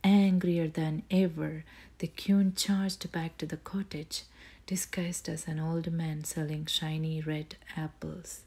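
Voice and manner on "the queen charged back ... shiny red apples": ordinary delivery, nothing marked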